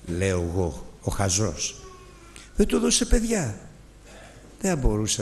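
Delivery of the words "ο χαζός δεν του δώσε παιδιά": normal talking voice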